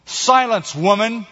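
high, stern rebuke; crying out. A narrator's reading. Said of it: "cried one of the cave dwellers".

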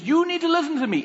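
high, emphatic telling-off; mock scolding. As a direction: imitate an exasperated husband laying down the law. Husband starts scolding, retold.

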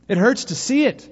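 high-pitched storyteller's outcry; pained cry. A narrator's reading. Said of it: "they cried".

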